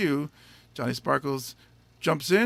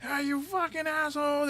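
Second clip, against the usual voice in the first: comedian yelling at crowd; 12 semitones higher, 5 dB quieter than usual. Retold or imitated. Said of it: "he goes up on stage and he's like".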